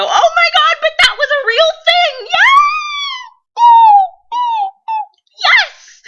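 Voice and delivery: very high, very loud fangirl shriek; excited screaming. Real, not acted. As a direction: scream with delight at the show. Fangirling over Sleepy Hollow.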